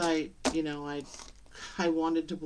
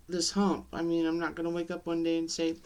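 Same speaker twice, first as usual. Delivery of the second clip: ordinary delivery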